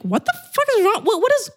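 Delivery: mock-exasperated yelp at a friend; exasperated outburst. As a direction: throw up your hands in disbelief. Acting out her own outburst.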